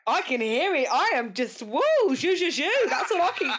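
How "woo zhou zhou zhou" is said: higher, giddy mock-French cooing. Host teasing a guest about his accent, giddy mock French. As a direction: coo playful French-sounding syllables.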